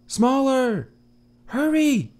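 high, loud urgent calls; urgent shout. Acted. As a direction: hurry him along. Munera urging Faraday.

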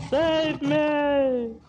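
high, long-held plea; crying for rescue. A narrator's reading. Hunter fleeing lions begs rescue, high drawn-out cry. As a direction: stretch out a high cry to be saved. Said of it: "he cried".

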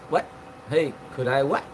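ordinary talk, nothing marked

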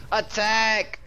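high, loud rallying command; battle call. Acted. Streamer rallying teammates with a loud battle command. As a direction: call the charge to the troops.

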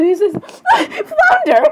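high, squealed cry through laughter; laughing outburst. Real, not acted. Calling Flounder over his face, cracking up. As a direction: call out while cracking up.

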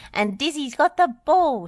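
high, loud match commentary; thrilled shouting; excited cry. A narrator's reading. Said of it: "she cried as she chased".

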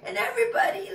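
high, loud, thrilled voice; loud high excited praise; excited wake-up. Pretend. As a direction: burst awake with delight. Pretend waking wide-eyed, excited.

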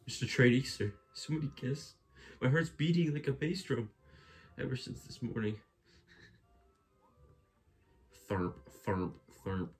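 high, excited toad voice; lovestruck toad voice. Acted. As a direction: gush like a lovestruck little toad. Character voice, heart pounding.

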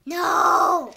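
loud cry of no; shouting. Real, not acted. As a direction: yell it out. Shouted refusal.

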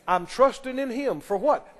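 ordinary delivery

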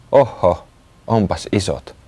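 ordinary delivery

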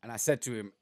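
ordinary talk, nothing marked

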